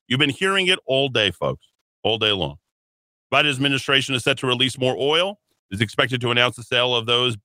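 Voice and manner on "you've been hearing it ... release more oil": ordinary delivery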